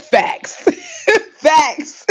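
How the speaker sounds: high, cracking up between words; laughing agreement. Real, not acted. Agreeing with a viewer about Plum Beach, laughing hard. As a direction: agree through bursts of laughter.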